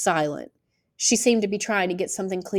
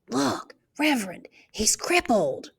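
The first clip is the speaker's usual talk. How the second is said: whisper; 4 semitones higher than usual. Acted. Children whispering in the balcony.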